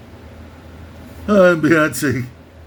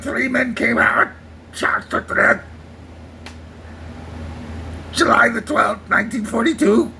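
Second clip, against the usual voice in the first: gruff Indianapolis speech impression; Quint from Jaws; 2 semitones higher than usual. Quint telling the Indianapolis story to Hooper, gruff impression. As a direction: growl it like the old shark hunter. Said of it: "my Quint is getting much better".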